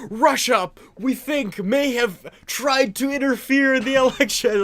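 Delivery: high, loud hammed-up headline read; mocking a CNN headline, read out high and loud; mock news anchor.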